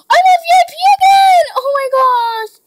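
high shrieking joy; excited shriek. Real, not acted. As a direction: gasp then shriek with delight. Gasp at becoming VIP.

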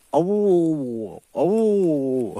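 high, loud mock howl like the dog; imitated dog howl.

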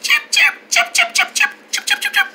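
rapid, very high chirps; bird chirping. Acted. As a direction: chatter like an excited little bird. Imitates happy bird chirping.

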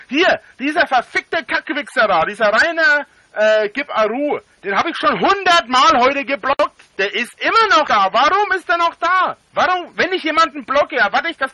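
berserk rage. Real, not acted. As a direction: scream at the troll in fury. Raging at a troll in chat.

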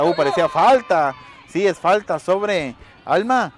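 loud, slow excited match commentary; excited play-by-play. Real, not acted. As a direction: call the action loud and thrilled. Live play-by-play after a goal.

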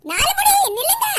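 high, loud yell to stop; yelling at the fleeing neighbour to stop, enraged; furious shout. Acted. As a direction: bellow it at her back. Calls fleeing woman to stop.

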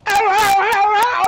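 high, loud yelped ows; mock pained cries. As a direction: yelp it like she's hurting. Imitating her cries.

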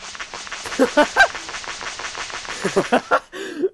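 long, mostly voiceless laugh; helpless wheezing laughter; real laughing fit. Real, not acted. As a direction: burst out laughing and keep going. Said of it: "es que me dio risa".

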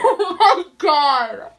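laughing through disgust; slow, loud laughing oh my god. Real, not acted. Laughing at the awful smell.

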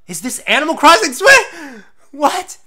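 loud, high disbelieving cry; shocked excitement. Real, not acted. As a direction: burst out in stunned excitement. First shocked reaction to the reveal.

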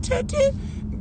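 high, soft little toots; car horn imitation. Mocking other people's car horns, high puny toots. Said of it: "Y'all horn be sound like".

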